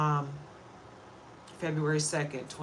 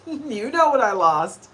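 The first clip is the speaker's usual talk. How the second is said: slower, louder, a laugh breaking into the words; laughing through tears; 5 semitones higher, 7 dB louder, slower than usual. Real, not acted. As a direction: laugh it off while holding back tears. Laughing through grief over mom.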